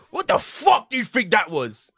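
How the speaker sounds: imitated outraged, high-pitched demand; Ray Winston angry. Actor berating a student before everyone, imitated anger. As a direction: tear into a bad performance. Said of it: "he was talking like that".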